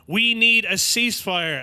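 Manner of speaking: loud, high, slow solemn politician pronouncement; Kamala Harris impression. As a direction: declare it with grave, staged importance. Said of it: "and she goes".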